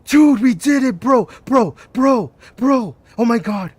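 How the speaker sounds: loud, high yells of disbelief; real overexcited bro hollering, easing slightly by the end; ecstatic shouting. Real, not acted. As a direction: celebrate wildly, repeating each word. Celebrating an unlock in game.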